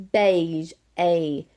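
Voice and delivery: beige and A in a strong, low Southern accent; deep Southern accent. Shown as a demonstration. Teacher repeats beige for the students, deep Southern accent. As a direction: exaggerate the Southern long A in beige. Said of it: "really strong for you".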